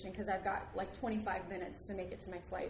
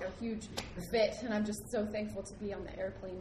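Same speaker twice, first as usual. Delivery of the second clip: ordinary talk, nothing marked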